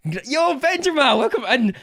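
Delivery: high, loud hail; excited greeting shout. Real, not acted. Streamer welcoming Benjamin into the stream, excited shout. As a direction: holler a delighted welcome. Hails an arriving viewer.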